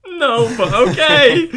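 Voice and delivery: high, slow, laughing "no but okay"; laughing reluctant yes. Retold or imitated. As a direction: squeal it out through a laugh. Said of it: "And I was like".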